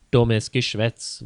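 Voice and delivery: plain reading voice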